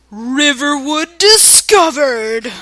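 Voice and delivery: loud, slow, drawn-out announcement; booming sky voice. Shown as a demonstration. Voice from the sky announces the town, booming. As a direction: boom it out from the heavens. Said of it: "boomed a voice from the sky".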